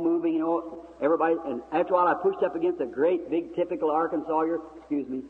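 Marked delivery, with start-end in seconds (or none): none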